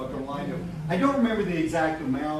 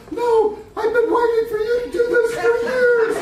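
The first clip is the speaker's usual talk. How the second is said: very high, squeaky imitation of his wife; mock wife voice; 8 dB louder than usual. Wife telling him she waited years, squeaky high mock voice. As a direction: pitch way up to play his relieved wife. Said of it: "She said".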